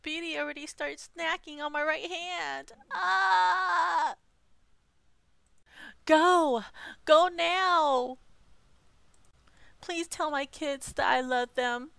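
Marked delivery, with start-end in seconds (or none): 2.9-4.1 sudden loud shout of pain; pained scream. Acted. Hamster bites his hand. 6.1-8.2 loud urgent shout to flee. Acted. Urging viewers to flee.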